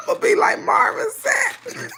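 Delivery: high, loud laughing yell; real laughter breaking into a yell; laughing shout. Real, not acted. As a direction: shout it while cracking up. Mock yell through laughter.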